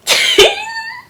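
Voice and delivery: high-pitched giddy squeal. Real, not acted. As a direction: squeal with delight. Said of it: "I'm getting so giddy".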